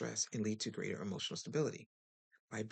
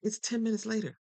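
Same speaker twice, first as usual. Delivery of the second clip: higher and louder mock report of the time; exaggerated sarcastic notice; mock announcement; 10 semitones higher, 7 dB louder than usual. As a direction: announce it like it's big news. Mocking over-explainers.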